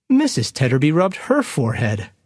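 ordinary talk

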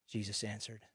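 ordinary delivery, nothing marked

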